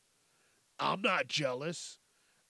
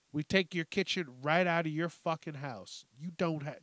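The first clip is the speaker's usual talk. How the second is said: usual speaking voice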